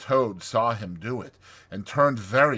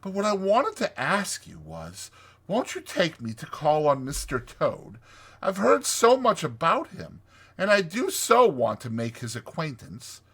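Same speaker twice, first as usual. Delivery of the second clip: high, eager request; eager Mole; 8 semitones higher, 4 dB louder than usual. Acted. Mole asks Rat to visit Toad, eager. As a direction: plead hopefully in a raised voice.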